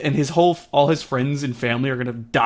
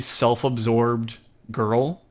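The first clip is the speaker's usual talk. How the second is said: usual speaking voice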